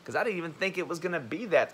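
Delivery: ordinary delivery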